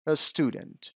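"a student" with s and t said together; blended pronunciation. Shown as a demonstration. Teacher applying the rule to student, clean blended pronunciation. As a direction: model "a student" with a joined "st".

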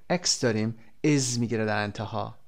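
normal talking voice

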